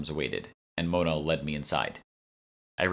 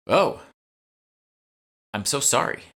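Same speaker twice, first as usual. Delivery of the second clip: high, loud startled gasp of an oh; sudden flustered sympathy; 8 semitones higher, 5 dB louder than usual. Acted. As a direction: catch her off guard completely. Curator startled by news.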